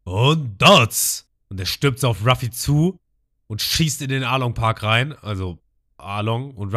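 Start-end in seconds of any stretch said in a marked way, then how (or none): 0.0-1.3 long, drawn-out, louder battle cry; attack shout. Retold or imitated. Arlong yells his attack name, long and loud. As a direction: stretch every syllable of the attack name. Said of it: "Der schreit".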